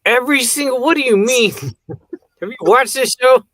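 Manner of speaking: can't believe what the friend is saying; incredulous outburst. Real, not acted. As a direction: shout in disbelief at your friend.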